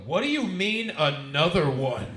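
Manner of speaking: loud, incredulous question slowing at the end; mock alarm. Twins react to the comic wanting another cookie, mock alarm. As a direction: imitate someone loudly taken aback.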